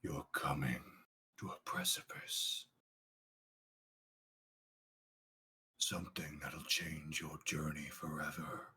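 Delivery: low, hushed, mysterious narration; ominous whisper. Acted. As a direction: whisper it like a prophecy from the void.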